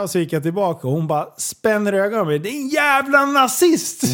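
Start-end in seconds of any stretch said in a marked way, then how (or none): 2.4-4.1 high, drawn-out imitation of a screamed insult; mock scream. Retelling the woman screaming after the shop worker, mock scream. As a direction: screech it slowly, not at full volume. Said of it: "skriker hon".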